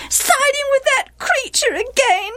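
high-pitched, aggrieved wail. A narrator's reading. Wife accusing husband of taking sides, wailing. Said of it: "wailed Gilead's wife".

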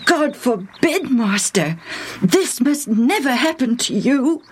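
high, pleading rebuke from Peter; Peter, sharp and high. A narrator's reading. Peter pulls Jesus aside to sharply protest. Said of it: "spoke to him sharply".

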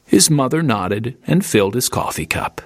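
plain reading voice

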